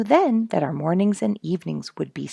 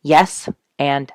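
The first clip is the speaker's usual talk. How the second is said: ordinary delivery, nothing marked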